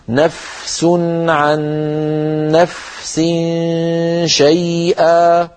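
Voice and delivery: slow, word by word, the فاء in نفس held long and soft; soft flowing fa. Shown as a demonstration. Teacher slowly modeling the soft fa. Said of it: "فلا بد أن نعطي الفاء حقها من الرخاوة".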